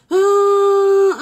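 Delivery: long, loud, high "aah"; gasp of recognition. Real, not acted. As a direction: draw out a high cry of sudden realisation. Suddenly recognises the commenter.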